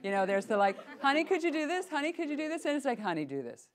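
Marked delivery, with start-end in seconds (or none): 1.0-2.6 high, sweetly pleading parent; a parent coaxing politely, voice lifted high; sugary request, rising sweetly; sweet asking parent. Retold or imitated. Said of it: "Parents have this". 3.0-3.7 low, firm parent command; grounded parental order, lower and level. Retold or imitated. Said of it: "Parents have this".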